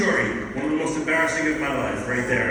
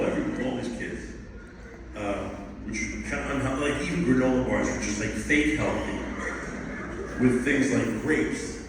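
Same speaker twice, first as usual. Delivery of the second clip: the guy's voice; 6 semitones lower, 6 dB quieter than usual. Retold or imitated. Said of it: "he goes".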